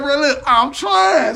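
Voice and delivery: high, drawn-out whine; mock exasperated plea; flustered protest. Repeats the flustered reply.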